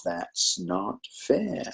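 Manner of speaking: drawn-out imitation of whining pupils; squealing complaint. Kids protesting the teacher's punishment, slow mock complaint. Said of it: "lots of squealing of".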